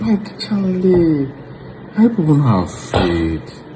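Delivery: very drawn-out, high-pitched appeal; exasperated disbelief. Real, not acted. Commenter appalled that girls follow a random stranger, exasperated. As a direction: stretch the words out in dismay.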